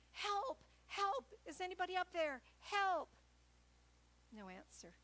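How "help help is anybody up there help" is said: high, loud cries for help; shouting for help. Retold or imitated. Hiker dangling from a root yelling up the ravine, desperate shout. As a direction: call up the cliff at the top of your lungs. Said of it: "I can shout".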